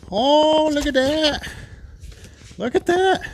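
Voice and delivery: high, thrilled look at that; delighted unboxing squeal; excited delight. Real, not acted. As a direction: gush over the new part.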